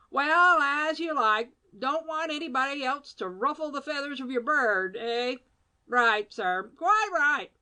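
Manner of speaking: a hint of roguish banter; Badger bantering. A narrator's reading. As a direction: wink through it.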